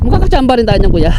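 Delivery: high, loud and fast; excited chatter. Real, not acted. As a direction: talk it up with energy. Hyped chat with kuya.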